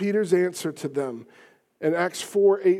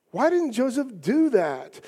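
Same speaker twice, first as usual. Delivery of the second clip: ordinary talk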